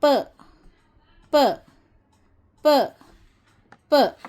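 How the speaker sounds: "प" as a short puffed pa, not "pee"; letter sound p. Shown as a demonstration. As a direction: sound out "प" crisply, repeated. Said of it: "इसकी आवाज़ होती है प".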